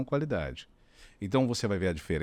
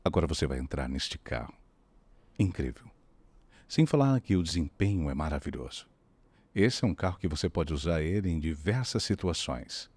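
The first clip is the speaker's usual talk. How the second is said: deep car ad voice; 6 semitones lower than usual. Shown as a demonstration. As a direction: sell the Uno like a luxury car. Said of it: "Aí então você imagina o seguinte". (locução grave de comercial de carro)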